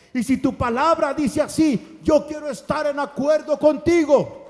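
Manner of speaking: loud preaching shout; shouting the vow to God; full-force preaching. Acted. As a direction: lift the congregation with your voice.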